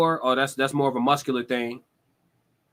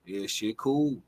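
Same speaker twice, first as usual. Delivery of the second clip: much softer, low, flat reply; unbothered; 4 semitones lower, 6 dB quieter, slower than usual. Retold or imitated. Answering the hyped friend, unbothered and soft. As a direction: shrug it off quietly. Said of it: "I'm just like".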